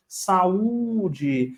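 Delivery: "Saúde" with the ú held long; "Saúde", lingering on its middle "ú"; stress on Ú. Shown as a demonstration. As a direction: draw out the ú in "Saúde" to show the strong syllable. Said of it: "a sílaba mais forte é a letra U".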